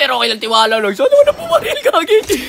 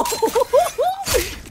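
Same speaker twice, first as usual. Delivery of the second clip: high laugh; 10 semitones higher, 5 dB quieter than usual. Real, not acted. Laughs at teammate's kill.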